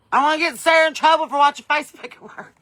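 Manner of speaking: loud, high playful tease; teasing. Pretend. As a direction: call her out in jest. Teasing a viewer watching at work.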